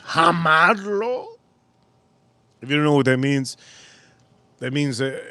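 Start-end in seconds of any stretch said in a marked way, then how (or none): 0.0-1.3 higher, slow, different voice; imitating neighbor. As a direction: say it like the neighbor, stretched. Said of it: "He goes".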